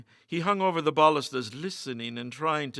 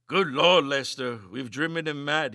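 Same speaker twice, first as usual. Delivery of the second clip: ordinary delivery, nothing marked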